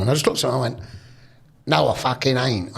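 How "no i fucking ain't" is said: slow put-on Cockney; drawn-out Cockney vowels, "naow" for no; Cockney accent. Young fan denying he is a Leicester supporter, Cockney accent. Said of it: "it was Cockney accent".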